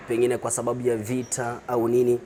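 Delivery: usual speaking voice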